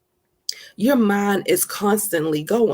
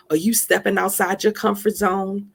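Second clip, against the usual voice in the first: ordinary talk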